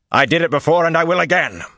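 loud, high, forceful vow; defiant shout. A narrator's reading. Angry vow in a heated exchange.

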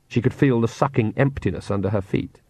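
plain reading voice

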